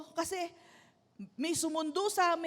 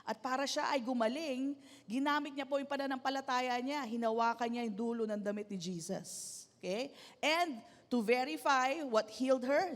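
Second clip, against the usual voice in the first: ordinary talk, nothing marked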